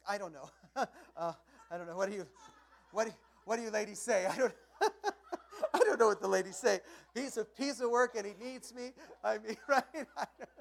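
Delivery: loud laughter through the words; laughing. Real, not acted. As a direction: laugh it out with the crowd. Laughing at his own joke.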